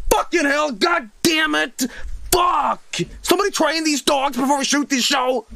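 high, shouted cursing tantrum; mock furious outburst. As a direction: blow up like a TV expert bitten by a dog. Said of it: "he's like".

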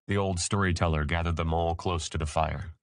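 plain reading voice

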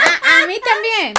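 high, loud, slow and laughing; loud laughing cry; shouted laughing. Real, not acted. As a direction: yell it through tears of laughter. Reacting loudly to the chat.

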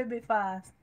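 ordinary delivery

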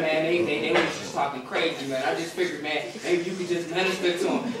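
lightly voiced low, subdued apology; imitated sheepish excuse. Saint excusing giving out the number, imitated sheepishness. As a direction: drop low and soft, mock meek.

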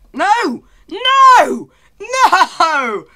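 loud, high wailing no; real dismayed shouts. Real, not acted. Gamer to the game after falling, dismayed shouting. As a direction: cry out in exaggerated despair.

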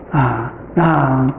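ordinary talk

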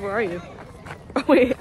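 ordinary talk, nothing marked

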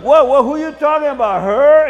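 alarmed, loud, high denial; caught off guard. Acted. Husband caught looking at women. (sorprendido y a gritos, voz más aguda)

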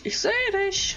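high teasing ich sehe dich on dich; sing-song taunt. Real, not acted. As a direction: taunt him like hide-and-seek. Taunting hidden player.

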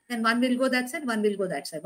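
normal talking voice

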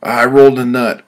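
loud high pained scream. Acted. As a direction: yell it out in agony. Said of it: "I screamed".

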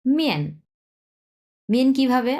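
মিয়েন started high and dropped sharply; falling fourth tone. Shown as a demonstration. Teacher models মিয়েন for students, sharp falling tone. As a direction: hit মিয়েন high then fall hard. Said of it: "মিয়েন কথাটা এইভাবে উচ্চারণ কেন করছি".

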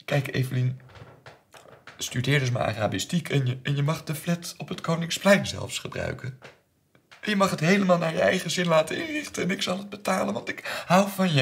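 high, loud, mounting plea; desperate appeasing. Retold or imitated. Father pleading, offering everything to daughter. (steeds hoger, wanhopig sussend)

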